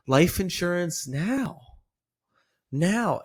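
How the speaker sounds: slow, with "now" high and stretched; drawn-out, wondering "now"; curious inflection. Shown as a demonstration. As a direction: lift and elongate "now" as a question. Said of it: "And then they're curious".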